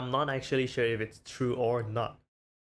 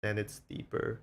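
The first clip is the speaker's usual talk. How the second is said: ordinary delivery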